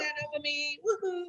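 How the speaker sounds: excited cheer. Real, not acted. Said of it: "I was excited".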